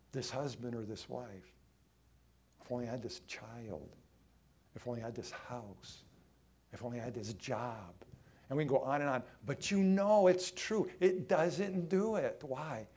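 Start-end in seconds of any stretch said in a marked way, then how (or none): 0.1-7.9 soft, low longing; quiet, lower-pitched pretend yearning; wistful wishing. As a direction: murmur each wish as if to yourself. Acted wishes of the discontented.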